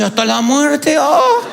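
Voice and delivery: mock groom gulping at the vow, then a laugh; hesitant vow. Preacher imitating a doubtful groom at the altar, laughing. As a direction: play the nervous groom.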